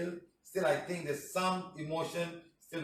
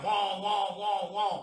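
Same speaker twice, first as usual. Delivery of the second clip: high wailing alarm noise; siren imitation; 4 semitones higher, slower than usual. Shown as a demonstration. Showing an alarm that gets everybody up, imitated siren wail. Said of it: "Put a big siren".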